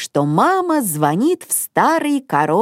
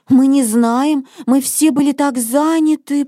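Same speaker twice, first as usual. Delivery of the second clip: plain reading voice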